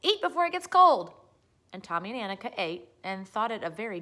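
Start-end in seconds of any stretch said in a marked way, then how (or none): 0.0-1.1 much louder, higher urging; loud cry. A narrator's reading. Pippi urging Tommy and Annika, loud cry. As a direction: call it across the kitchen. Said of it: "she cried".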